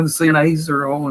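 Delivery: normal talking voice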